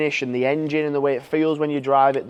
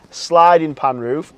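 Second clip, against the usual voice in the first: "sliding" punched loud and drawn out; emphatic, stretched-out "sliding" before "pan roof"; stress on sliding; 6 dB louder, much slower than usual. Real, not acted. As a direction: lean hard and slowly on the word "sliding". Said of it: "reason I emphasise the word sliding".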